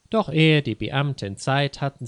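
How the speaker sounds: ordinary delivery, nothing marked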